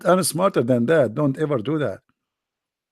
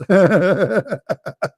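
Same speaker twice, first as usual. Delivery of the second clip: real laugh; 4 semitones higher than usual. Real, not acted. Laughs at the punishment joke.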